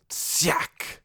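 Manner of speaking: whispered stabbing sound effect; sharp voiceless slicing noise; sword stab noise. A narrator's reading. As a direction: imitate a blade striking home. Sword stabs the monster.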